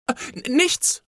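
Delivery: put-on high, stammering child caught out; flustered, high-pitched denial; startled. A narrator's reading. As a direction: stumble over it in a fright, voice jumping up. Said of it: "erschrak Anna".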